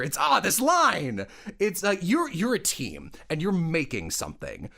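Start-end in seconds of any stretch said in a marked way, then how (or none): 0.2-1.2 high, drawn-out playful groan; mock frustration. Director blaming the line instead of the actor, mock frustration. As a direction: pretend the line is your enemy.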